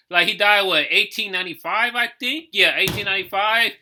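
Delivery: usual speaking voice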